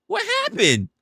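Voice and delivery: high, startled outcry; imitating own stunned reaction; retold shock. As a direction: replay the shock of seeing deleted messages. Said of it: "I said".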